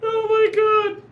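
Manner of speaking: very high, squeaky gasp of words; laughing squeal. Real, not acted. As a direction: squeal it out through real laughter. Breathless from laughing at joke.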